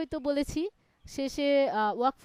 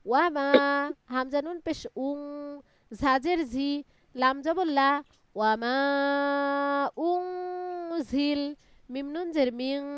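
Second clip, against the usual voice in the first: measured recitation with long held syllables; Quran spelling drill; 4 semitones higher, 5 dB louder than usual. Shown as a demonstration. Teacher leading a student through Quran spelling, measured drawn-out recitation. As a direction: spell out each piece, then draw the joined word out.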